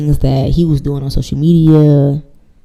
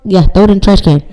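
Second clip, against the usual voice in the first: usual speaking voice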